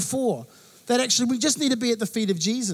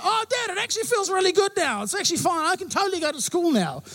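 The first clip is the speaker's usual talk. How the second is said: put-on much higher, bright little boy voice; cheery son; 8 semitones higher than usual. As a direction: imitate a kid suddenly all better. Son suddenly recovered.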